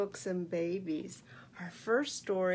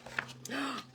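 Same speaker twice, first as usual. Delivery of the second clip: mock gasp; 4 semitones higher, 5 dB quieter than usual. Goose gasps at journal.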